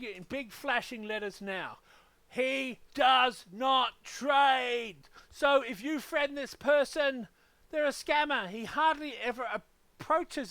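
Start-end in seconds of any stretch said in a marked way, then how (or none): 2.3-8.5 he does not trade, each word hammered slow and loud; slow shouted emphasis. Real, not acted. Warning viewers about the fake account, slow emphatic shout. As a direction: spell it out in big flashing letters. Stressing warning in big letters.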